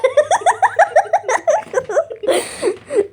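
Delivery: high cackling laugh. Real, not acted. Laughing hard after being hurt.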